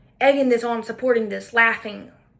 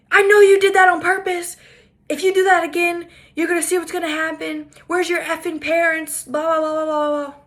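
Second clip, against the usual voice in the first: shrill-pitched mock rant, higher and louder; imitating her screaming; 5 semitones higher, 5 dB louder than usual. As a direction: ham up the angry woman yelling at a child. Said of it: "screaming profanities at this three-year-old child".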